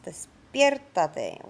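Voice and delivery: despiértate slowed, the E in PIÉR leaned on; stress on the E. Shown as a demonstration. Teacher modelling the accent, despiértate with the E stressed slowly. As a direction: draw out despiértate and hit the E. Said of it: "put emphasis on the E".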